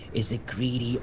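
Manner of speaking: ordinary delivery, nothing marked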